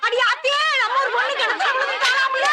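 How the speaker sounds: very high, exclaiming cry; alarmed outcry. Acted. Village girl spreads word of a fall into the well, alarmed outcry. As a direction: cry the news out high for all to hear.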